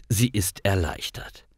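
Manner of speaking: plain reading voice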